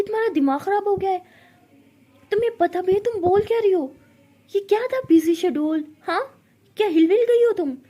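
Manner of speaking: loud, high, rapid anger; furious. Acted. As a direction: shout at her in disbelief. Ishani grabs Pari's hand, furious. (गुस्से में चिल्लाना)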